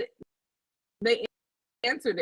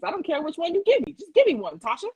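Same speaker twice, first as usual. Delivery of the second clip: eager volunteer; 7 semitones higher, faster than usual. Retold or imitated. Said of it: "I'm like".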